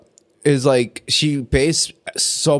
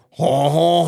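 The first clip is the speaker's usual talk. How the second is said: put-on French accent. Cohost given French in accent challenge, mock French laugh. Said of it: "Okay, French".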